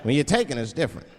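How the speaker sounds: ordinary delivery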